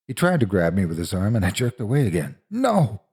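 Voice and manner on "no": high-pitched cry of refusal; terrified cry. A narrator's reading. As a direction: cry out while pulling away. Child jerks away from Stanley.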